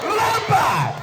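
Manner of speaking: high-pitched jump command; perintah komandan. Acted. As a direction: order him out with a high yell. Commander orders reluctant jumper out. (perintah berteriak, nada tinggi)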